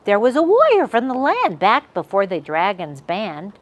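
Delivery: ordinary delivery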